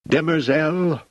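high shout of a name. A narrator's reading. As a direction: call him out sharply. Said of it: "literally shouted".

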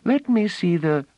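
put-on little girl pleading; eager child. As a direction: beg like a small excited girl. Child begging to see flute.

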